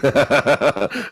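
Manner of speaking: rhythmic chuckle; real laugh. Real, not acted. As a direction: chuckle at your own joke. Laughs at own joke.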